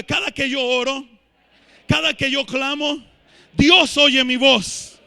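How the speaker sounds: rallying declaration. Real, not acted. Preacher has congregation raise hands and declare, building loudly. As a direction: build to a loud, drawn-out finish.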